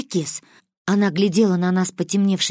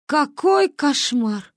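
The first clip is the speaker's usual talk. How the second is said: slow, higher wailing lament; 7 semitones higher, much slower than usual. A narrator's reading. Grandmother lamenting the girls' plan. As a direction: wail it slowly like a shocked grandma. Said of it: "запричитала она".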